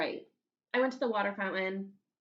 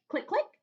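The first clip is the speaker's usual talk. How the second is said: stapler clicks; 10 semitones higher, 4 dB louder than usual. Acted. Stapler sound in story.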